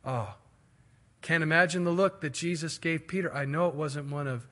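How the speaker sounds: normal talking voice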